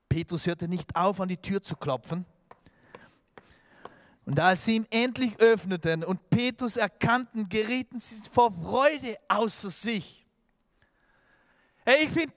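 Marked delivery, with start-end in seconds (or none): none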